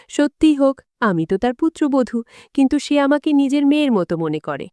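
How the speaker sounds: ordinary delivery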